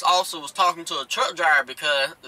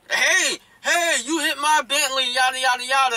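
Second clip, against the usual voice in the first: higher-pitched, indignant calling out; mock protest, pitched up; retold outraged car owner; 7 semitones higher than usual. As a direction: mimic an upset man hollering over his car. Bentley owner confronts the truck driver.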